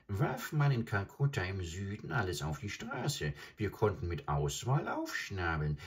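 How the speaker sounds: put-on high croaking bird voice, relishing the old days; a greedy stork fondly recalling feasts; high bird voice, nostalgic and greedy; adjutant bird reminiscing. Adjutant reminisces about Calcutta.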